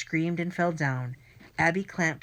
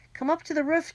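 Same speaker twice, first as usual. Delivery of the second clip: higher pleading plea; begging; 11 semitones higher, 3 dB louder, faster than usual. A narrator's reading. Friend begging the narrator to come to the roof, pleading. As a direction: beg him to follow. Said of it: "he begged".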